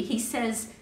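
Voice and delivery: ordinary delivery, nothing marked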